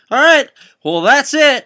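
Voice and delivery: loud, high-pitched host sign-off; big shouted wrap-up; show sign-off. Acted. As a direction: call it out high to the crowd. Host signs off to fans.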